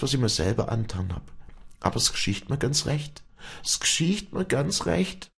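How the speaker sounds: high, impassioned confession in a woman's voice; anguished high-pitched outpouring; distraught Wally. Acted.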